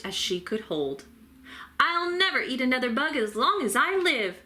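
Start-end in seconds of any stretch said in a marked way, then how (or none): 1.8-4.4 cheered. A narrator's reading. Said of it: "cheered Stella Luna".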